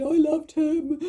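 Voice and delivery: high, loud crying cry; a retold heartbroken wail; retold sob. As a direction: wail it out through tears. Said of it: "I started crying and I said".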